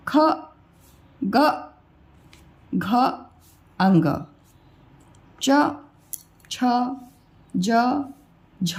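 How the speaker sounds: each letter from ख to श्र alone with a short schwa; teacher reciting consonants, long pause after each; consonant pronunciation demo. Shown as a demonstration. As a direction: isolate every consonant, brief 'uh' vowel. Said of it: "अब व्यंजन को कैसे पढ़ते हैं".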